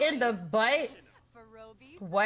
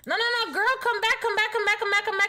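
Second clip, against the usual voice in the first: high, urgent come back come back; calling after her, desperate; frantic pleading; 7 semitones higher, faster than usual. Real, not acted. Streamer pleads as the character leaves, high urgent calling.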